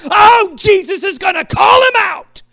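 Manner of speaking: high, loud, thrilled outburst; excited. Pretend. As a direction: burst out like a first-time reader caught up in it. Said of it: "so I could get excited and go".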